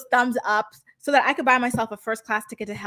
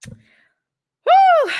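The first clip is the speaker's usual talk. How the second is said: a sudden squealed whew, very high and loud; 10 dB louder than usual. Real, not acted. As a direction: let out a shocked, very high whew. Reacting to Stefan on screen.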